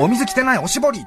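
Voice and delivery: complaining customer. Retold or imitated.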